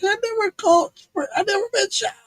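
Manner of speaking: very high, strained protest; exasperated protest. Retold or imitated. Retold protest at ambulance being sent.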